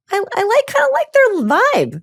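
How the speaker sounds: very high, breathless gushing over a crush; squeaky excited crush. Retold or imitated. Coach imitates someone gushing about a person they like, very high. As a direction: gush it up high like a giddy teenager. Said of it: "to not hey a person you're like".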